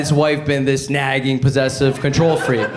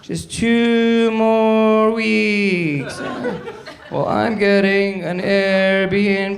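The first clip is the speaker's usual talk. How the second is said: higher, very slow drawn-out reply; wife voice; 5 semitones higher, much slower than usual. Acted. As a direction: stretch each word out as the wife. Wife replies in acted exchange.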